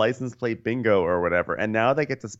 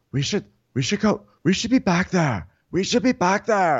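high, whiny hammed-up urgency; put-on fretting voice; mock distraught plea; 10 semitones higher, 4 dB louder than usual. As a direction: plead it high, more insistent each time. Acting out Lisa's tearful plea.

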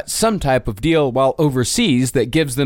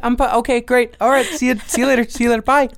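hurt puppy voice; 9 semitones higher, much faster than usual. Retold or imitated. Acting the rejected dog.

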